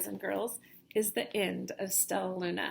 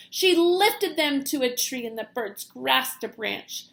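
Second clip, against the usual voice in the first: ordinary delivery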